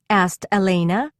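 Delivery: ordinary delivery, nothing marked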